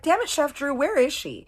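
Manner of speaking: high, loud mock scolding; exasperated outburst. She demands to know where his fiancee is, exasperated. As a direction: scold him in hammed-up exasperation.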